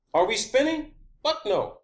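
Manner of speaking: ordinary talk, nothing marked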